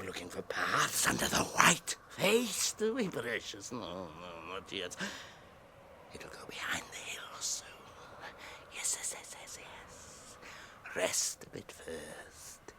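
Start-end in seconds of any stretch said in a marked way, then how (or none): 6.1-12.7 soft unvoiced whisper to himself; creature whisper. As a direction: murmur it to yourself, barely voiced.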